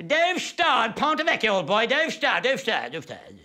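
high, loud fake plummy English accent; mock stiff-upper-lip English radio actors; hammed-up ersatz British accent; mock posh English. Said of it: "stiff upper lip ersatz English accents".

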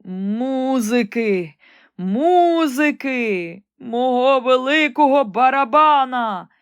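a dying emperor crying out for music; desperate cry. Acted. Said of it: "кричав він". (відчайдушний крик)

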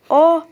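loud startled exclaim; surprised gasp. Real, not acted. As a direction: yelp in sudden delight. Finds a secret room.

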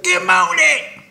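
imitated very high, slow greeting; guy's morning greeting. Retelling how this guy greets people, high slow mimicry. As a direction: mimic him far above your own pitch. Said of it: "he's like".

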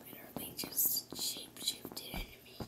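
hushed whispered aside; whispering. Real, not acted. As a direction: whisper it to yourself.

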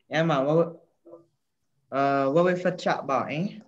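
put-on a boy's light Jamaican patois, half-shy. Shown as a demonstration. Boy shows the host the patois he knows, lightly. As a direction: try out patois slang, casually. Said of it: "What words you know, [name]?".

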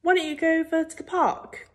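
high-pitched, coaxing mother; exasperated parent, pitched well up; nagging mum. A narrator's reading. Mum nags son again.

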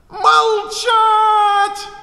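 a very high, loud, sustained bellow; the Queen screaming for silence; a drawn-out enraged scream; furious roar. A narrator's reading. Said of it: "заорала королева".

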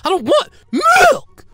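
high, loud, slow crying wail. Acted. Boy forced to drink milk, wailing in tears. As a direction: bawl it like a cranky child. Kid wails over milk.

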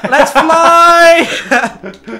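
high, loud joyful cry; excited shout. Real, not acted. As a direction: shout with glee as you take off. Taking off in game.

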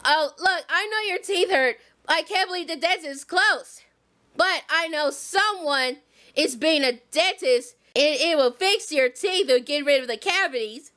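deep motherly warning; Harriet voice. Pretend. Harriet voice again.

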